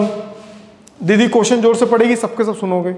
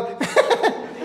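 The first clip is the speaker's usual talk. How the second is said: high breathy laugh; 12 semitones higher, 6 dB quieter than usual. Real, not acted. Laughing mid explanation.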